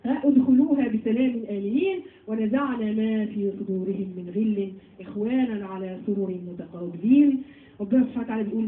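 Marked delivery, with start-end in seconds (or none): 0.2-7.4 slow measured recitation of the verse; Quran recitation. Acted. Teacher reciting the verse slowly to her students. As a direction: recite it slowly, set apart from the talk.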